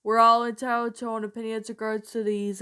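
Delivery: ordinary talk, nothing marked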